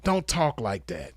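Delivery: ordinary delivery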